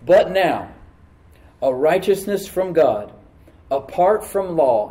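slow, spaced reading with pauses between phrases; deliberate slow scripture reread; read slowly. Shown as a demonstration. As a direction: let each phrase land, then pause. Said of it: "Let me read that again slowly".

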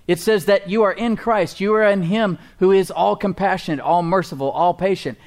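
high, loud preacher's build; full-force pulpit preaching. Acted. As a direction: lift the crowd with rising fervor.

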